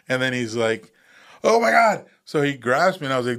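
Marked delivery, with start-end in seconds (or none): 1.4-2.0 high, loud burst of alarm; shocked oh my God. Retold or imitated. Soldier finding his wounded buddy alive, alarmed high cry. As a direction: gasp it out, stunned he's alive.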